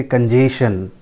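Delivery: कंजेशन as kun-JAY-shun; common mispronunciation. Shown as a demonstration. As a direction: drop the T sound in कंजेशन. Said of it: "not correct". (कंजेशन का गलत उच्चारण, बिना च के)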